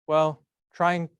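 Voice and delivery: usual speaking voice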